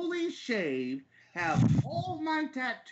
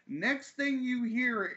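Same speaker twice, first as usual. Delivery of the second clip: ordinary delivery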